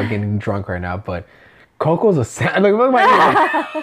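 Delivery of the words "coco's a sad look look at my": tipsy tears breaking into laughter; laughing through tears. Real, not acted. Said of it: "You're really crying".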